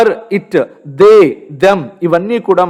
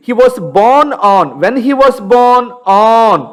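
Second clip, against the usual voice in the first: slow dictation; 4 semitones higher, much slower than usual. Shown as a demonstration.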